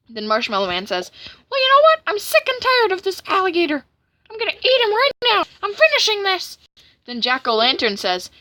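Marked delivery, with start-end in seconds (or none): 1.5-6.6 very high, loud angry character voice; hammed-up, fed-up tirade; fed-up cartoon villain. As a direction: rant in a strained high cartoon voice.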